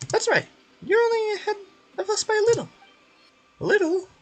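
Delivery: loud, cocky little companion; high and loud, mocking rivals; high taunting sidekick.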